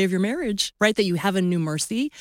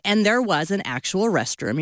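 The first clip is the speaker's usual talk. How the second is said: ordinary talk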